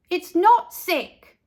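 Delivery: high, loud, slow protest; insistent. A narrator's reading. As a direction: stand up for your lunch. Carla insists, offended.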